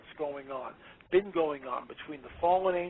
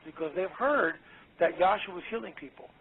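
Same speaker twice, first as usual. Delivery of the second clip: ordinary delivery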